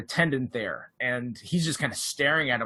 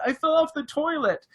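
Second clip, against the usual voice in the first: much higher put-on pitiful voice; high-pitched voice; 11 semitones higher than usual. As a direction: pipe it up high and helpless. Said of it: "He's using that high-pitched voice the whole time".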